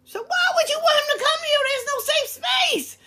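high, loud cry of disbelief; shouting in real outrage; outraged shout. Real, not acted. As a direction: yell it at the unfair mother. Outraged at the mother's cruelty.

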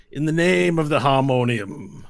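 loud, slow demand in the knight's voice; commanding knight. Acted. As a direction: challenge them in the Harmonium's name.

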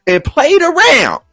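mock outrage. Podcast host ranting at a contestant's wig, mock furious. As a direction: play up being offended for laughs. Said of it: "and I am pissed".

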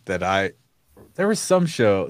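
normal talking voice

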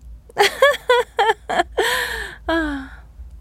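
long, high-pitched cackling laugh; high old woman's cackle. A narrator's reading. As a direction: let out a high, falling cackle. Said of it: "continued to laugh to herself".

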